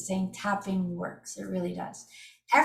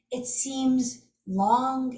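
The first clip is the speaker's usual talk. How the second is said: it seems long, stretching long out slowly; drawn-out long; 3 semitones higher, much slower than usual. Shown as a demonstration. Telling viewers how tapping feels at first, slowly drawn out. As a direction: drag the phrase to sound endless.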